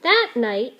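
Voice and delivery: plain reading voice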